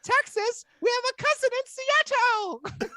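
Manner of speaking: slow, very high mock London accent; Izzy's London accent. Girl thrilled to meet a Texan, high London accent. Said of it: "And she goes".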